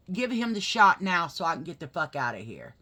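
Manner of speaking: loud re-enacted order; forceful, angry retelling; retold pissed-off demand. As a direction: relive demanding it so you can leave. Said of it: "I was freaking out and pissed off".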